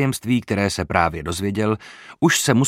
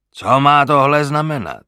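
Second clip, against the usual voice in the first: rough, louder and higher demand; harsh demand; 5 semitones higher, 5 dB louder than usual. A narrator's reading. Armed man storming in demands an explanation, loud and rough. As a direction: bark the question at the brawlers. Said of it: "zeptal se drsným hlasem".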